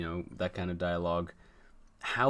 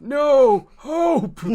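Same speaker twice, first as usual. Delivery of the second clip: huge high-pitched mock wail; melodramatic cry; 14 dB louder than usual. Father falling to his knees, melodramatic scream. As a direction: scream it over the top, wildly high. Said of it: "do a really melodramatic".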